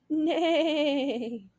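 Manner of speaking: drawn-out neigh; horse neigh. Acted.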